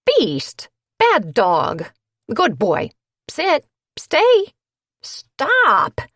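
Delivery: loud high dog commands; commanding. A narrator's reading. Owner ordering her dog off Gabby, loud high commands. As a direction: bark orders at an unruly dog. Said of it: "declared uselessly".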